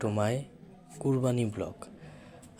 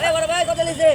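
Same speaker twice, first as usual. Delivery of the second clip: calling out; 7 semitones higher, 10 dB louder, faster than usual. Real, not acted. Calling out across cattle market.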